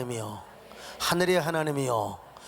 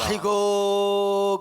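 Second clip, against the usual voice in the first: drawn-out groan; 4 semitones higher, 5 dB louder, much slower than usual. Acted. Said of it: "groaning exclamation".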